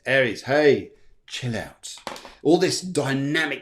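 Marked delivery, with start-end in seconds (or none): 0.4-1.9 laid-back chill. Retold or imitated. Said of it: "Susan Avery says".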